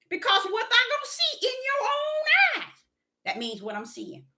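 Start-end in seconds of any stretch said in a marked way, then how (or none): 0.1-2.8 high crying shout of revelation; fervent cry. Retold or imitated. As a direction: cry it out like a prophecy. Voicing Father's answer, crying out.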